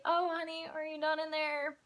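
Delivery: high, sing-song mom voice; mom calling out. Retold or imitated. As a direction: call in lightly like a checking-up mom. Mom voiced, checking on daughter in bathroom.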